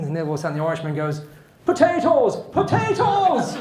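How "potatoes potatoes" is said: very high, loud yelp, second one stretched; shouted punchline. Acted. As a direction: yell it out as the kicked Irishman. Irishman shouts punchline from sack.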